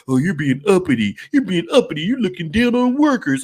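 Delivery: loud, high accusing mock critic voice. Critics accusing him of looking down, loud high mock whine. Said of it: "they're like".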